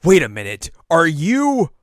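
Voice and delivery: loud, climbing to a high drawn-out shriek; excited realisation. Retold or imitated. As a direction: build to an incredulous, hammed-up squeal. Clueless vice president's excited realisation.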